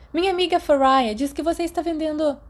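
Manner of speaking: put-on a sixth-year girl leaning in to ask Remus; teenage girl. A narrator's reading. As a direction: pitch way up to voice the older girl. The girl keeps talking.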